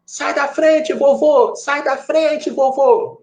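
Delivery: high-pitched mock yell; grito imitado. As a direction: imitate an impatient driver yelling, pitch way up. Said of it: "aí gritou assim". (grito agudo imitando motorista impaciente)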